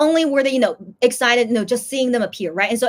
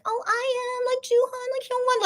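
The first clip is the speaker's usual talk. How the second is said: very high squealing fan voice; squealing fangirl; 13 semitones higher than usual. Retold or imitated. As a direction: squeal the names like a giddy fan. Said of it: "some fans saying".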